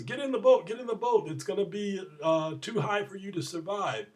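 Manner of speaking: high, acted call from a boat, easing off; urgent warning. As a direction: call out to hurry someone aboard. Boatman urges man to flee storm.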